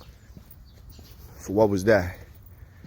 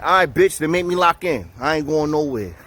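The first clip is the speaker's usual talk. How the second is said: defiant; 5 semitones higher than usual. Retold or imitated. Man refusing to go anywhere once locked in, defiant. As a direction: refuse to budge, higher and punchy.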